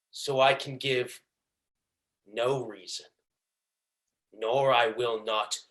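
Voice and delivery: quiet, restrained villain withholding his reasons; Shylock. Acted. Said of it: "hatred and pleasure that I tried to deliver this monologue".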